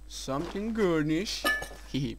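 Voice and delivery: ordinary talk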